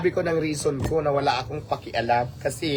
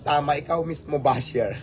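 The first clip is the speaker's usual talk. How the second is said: normal talking voice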